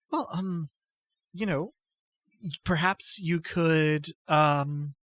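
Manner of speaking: put-on a timid goddess-follower greeting an old friend; timid noble lady.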